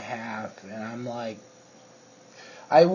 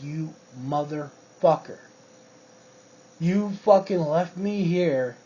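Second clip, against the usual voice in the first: retold angry yell; 4 semitones higher than usual. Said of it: "I was just tired and fucking yelling".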